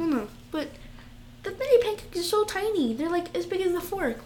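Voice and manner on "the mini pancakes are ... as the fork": much higher, squealing that they're so cute; gushing over cuteness. Real, not acted. Gushing to her friend about mini pancakes, squealing high. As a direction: coo over the tiny pancakes.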